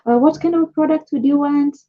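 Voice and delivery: a supplier greeting a buyer, eager; cheery supplier greeting. Retold or imitated. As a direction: play the friendly supplier. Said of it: "A supplier say".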